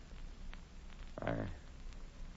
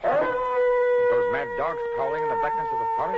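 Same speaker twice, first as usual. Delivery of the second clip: much higher, louder, slow alarmed cry; alarmed warning; 7 dB louder, slower than usual. Acted. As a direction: sound the alarm about the dogs. Warning of dogs in forest.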